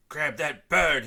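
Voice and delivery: furious brother yelling at the bird; shout. A narrator's reading. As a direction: bellow the order at the bird. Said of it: "he shouted".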